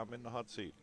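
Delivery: playful imitation of a Greek player's accent. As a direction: toss off a jokey Greek accent. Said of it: "That was my Greek accent".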